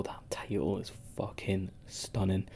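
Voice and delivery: hushed, breathless admiration of the belt; awed whisper. Real, not acted. Podcaster admiring a red title belt in a whisper. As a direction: whisper it like it's too beautiful to say aloud.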